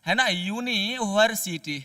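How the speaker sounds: यूनिवर्सिटी said slowly, starting with a clear यू sound; pronunciation demo. Shown as a demonstration. Teacher showing class how यूनिवर्सिटी begins. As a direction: stretch out यूनिवर्सिटी, yoo at the start. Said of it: "इसका उच्चारण करना है, यूनी".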